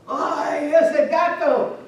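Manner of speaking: loud, high, drawn-out cry; shaken exclamation. Acted. Father sitting down safe at home, shaken exclamation. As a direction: exclaim in acted relief and fright.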